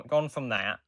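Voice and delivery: ordinary delivery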